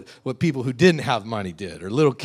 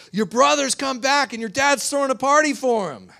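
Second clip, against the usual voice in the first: very high, loud announcement; excited servant; 14 semitones higher, 6 dB louder than usual. Acted. Servant telling older brother about the party, excited. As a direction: burst out with the big news. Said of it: "the servant says".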